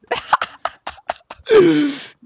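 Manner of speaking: loud shrieking laughter; high giddy laugh. Real, not acted. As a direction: cackle in triumph. Victory laughter.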